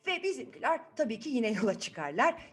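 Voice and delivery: ordinary delivery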